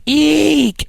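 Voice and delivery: high piercing mock "eek" shriek; girly scream. Retelling a dream to the coworker in it, high mock screech. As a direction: squeal it like a screaming girl. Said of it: "you screamed like a girl".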